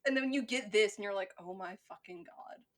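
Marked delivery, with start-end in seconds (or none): 1.4-2.6 very soft, deflated disbelief; stunned reaction. Retold or imitated. Podcaster acting out the viewer's reaction, quietly stunned. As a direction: let it fall away under your breath. Retold reaction after sitting through film.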